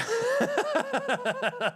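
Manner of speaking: short high-pitched cackle; real amused cackle; high laugh. Real, not acted. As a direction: laugh at the idea of carrots. Laughing at own joke.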